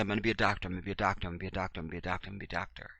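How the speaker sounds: quiet, low repeated chant; hushed, running repetition; repeated mantra. Acted. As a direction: reel it off softly like a mantra. Acts kid repeating an ambition.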